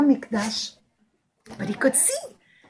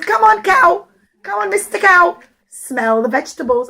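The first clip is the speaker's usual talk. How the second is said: coaxing; 6 semitones higher, 4 dB louder than usual. Acted. Poor man coaxing cow.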